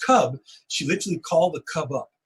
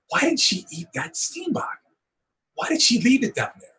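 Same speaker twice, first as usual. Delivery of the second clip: puzzled self-talk; 9 semitones higher than usual. Retold or imitated. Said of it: "I'm going to myself".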